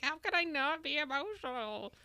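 high-pitched mock wail; emotional outburst. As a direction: wail it in exasperated protest. Retelling an emotional argument with ex.

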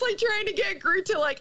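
normal talking voice

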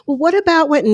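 high-pitched imitation of a protester; mocking high voice of someone arguing back; mock objector. Voicing objectors before cutting them off.

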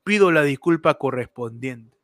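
low, soft official apology; Pedro Castillo. Retold or imitated. Host quotes the president's statement to the press, low and subdued. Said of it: "Pedro Castillo, mano. Declara la prensa".

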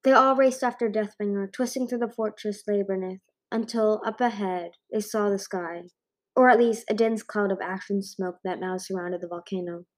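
usual speaking voice

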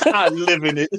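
laughing as you echo the line; amused echo with laughter; laughing repeat. Retold or imitated. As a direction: quote it back through a laugh.

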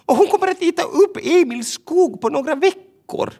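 bird working herself into panic; building worried bird voice; Tova the bird. Acted. Tova voiced, rising to alarm.

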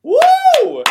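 loud, high "woo" cheer; excited whoop. Real, not acted. Host cheers the guest's rap, excited whoop. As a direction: whoop long and high for the performer.

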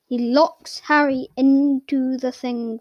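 ordinary delivery, nothing marked

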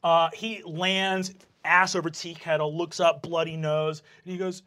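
ordinary delivery

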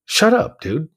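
high, loud mock-annoyed brush-off; dismissive retort. Inmate brushes off the man who called him angry, dismissive retort. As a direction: shoot it back high and sharp.

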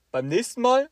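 loud, high telling-off; teacher scolds the student over crumbs, loud and high; scolding teacher. Retold or imitated. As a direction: tell him off about the crumbs.

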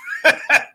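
short high-pitched laugh. Real, not acted. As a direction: let out a quick high laugh. Laughs after inviting rednecks in.

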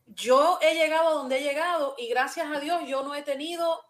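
ordinary talk

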